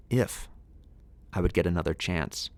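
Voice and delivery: ordinary delivery, nothing marked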